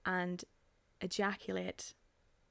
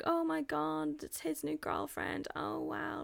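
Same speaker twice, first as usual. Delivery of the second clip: mock gushing; 8 semitones higher, 4 dB quieter, slower than usual. Imitating friends' group chat messages.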